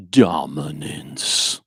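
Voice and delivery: very slow, drawn-out menacing word; scary voice. Shown as a demonstration. Teacher redoing the word for the class in a scary voice. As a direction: stretch it out like a looming threat. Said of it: "I should say that, uh, with a, a, a, like, a scary voice".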